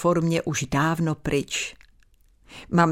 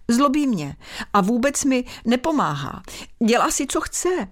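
complaining; 6 semitones higher than usual. Acted. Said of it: "znovu si postěžovala Lucka".

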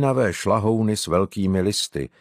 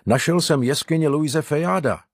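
higher-pitched, lightly narrated call; mild called-out greeting; 6 semitones higher than usual. A narrator's reading. Boy announcing his cave find on return, mildly calling out. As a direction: call it out as news, without real shouting. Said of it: "křikl Arnošt na uvítanou".

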